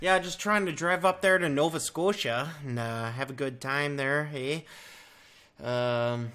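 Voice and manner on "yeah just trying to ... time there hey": raised, louder friendly Canadian; Canadian accent. Acted. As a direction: put on a folksy Canadian accent. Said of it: "have to have the right voice".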